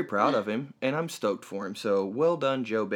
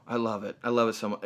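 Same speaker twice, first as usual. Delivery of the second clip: usual speaking voice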